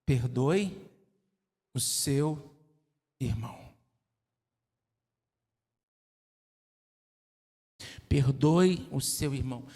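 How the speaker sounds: a hint of a divine voice; voice of the Lord. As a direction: speak as God, grave and measured. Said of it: "Mas ele fala".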